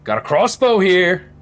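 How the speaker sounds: herd them out like a tipsy bouncer; drunk Hagrid. Acted. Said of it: "How drunk is he?".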